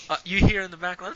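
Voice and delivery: normal talking voice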